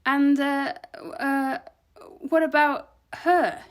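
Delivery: high, halting question from a nervous assistant; hesitant. A narrator's reading. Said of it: "Bianco hesitates for a moment".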